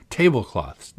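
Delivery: plain reading voice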